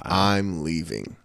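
slow, soft dismissive exit; drawn out, done with her. Retold or imitated. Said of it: "I was like".

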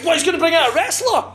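put-on excited kid. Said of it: "And he".

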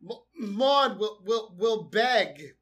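drawn-out, stumbling pleading; lightly voiced slow mock plea; slow halting quote. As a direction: drag each word out like a desperate man. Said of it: "Arthur is saying".

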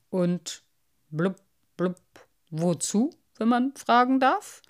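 mocking old carp with burbling blubs; a know-it-all fish looking down on the collection. Carp asks.

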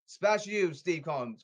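ordinary delivery

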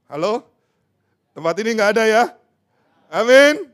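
loud fiery preacher; preaching at full force. Acted. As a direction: lift the hall with a joyful shout. Shouting praise climax.

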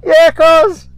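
high, loud fake encouragement; sarcastic cheer. Surfer taunting him as he paddles back, high sarcastic cheer. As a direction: egg him on mockingly, all fake hype. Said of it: "I talked to him again".

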